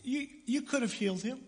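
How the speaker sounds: grieving sister pleading with Jesus; Mary's anguished plea. Retold or imitated. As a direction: beg him with grief and reproach. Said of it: "the reaction of Mary was one of".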